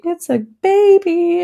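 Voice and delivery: put-on tearfully amazed child; teary wonder. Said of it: "Ella was like".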